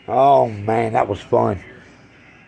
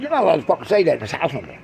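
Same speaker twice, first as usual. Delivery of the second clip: ordinary talk, nothing marked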